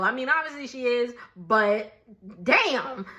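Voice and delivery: usual speaking voice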